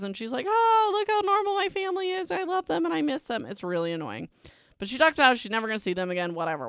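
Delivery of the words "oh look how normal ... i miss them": very high, sing-song mocking voice; hammed-up sentimental heroine, pitched way up; mock sappy gushing. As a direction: gush in a high, put-on sappy voice. Mocks heroine's sappy thoughts at dinner.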